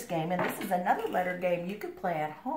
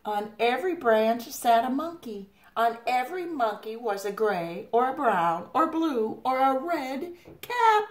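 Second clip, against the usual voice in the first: loud, high storyteller; big reveal for young listeners; animated story reading; 7 semitones higher, 6 dB louder than usual. A narrator's reading. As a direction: build excitedly to the last word. Reads story to children.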